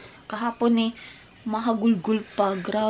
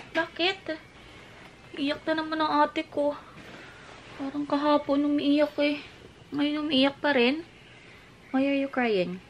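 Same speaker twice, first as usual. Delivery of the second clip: high, cooing sympathy; gently fussing parent voice; 6 semitones higher than usual. Real, not acted. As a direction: talk high, as to an upset little one. Parent comforting a crying child.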